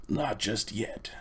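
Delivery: slow, whispered refusal; menacing whisper. A narrator's reading. Uncle Andrew refuses to release the children, slow menacing whisper. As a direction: drawl it out quietly, threatening.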